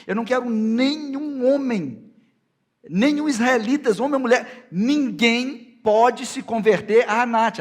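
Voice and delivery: loud, high, thundering sermon delivery; full-force preaching. Acted. Preacher voices God forbidding the cult of Anat, full-force preaching. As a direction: proclaim God's ban at full voice, high and forceful.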